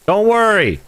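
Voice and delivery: high squeaky reassurance. Real, not acted. Reassuring himself while lost.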